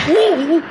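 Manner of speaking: high, loud ooh; startled yelp. Acted. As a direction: break off with a yelp. Cut off while explaining himself.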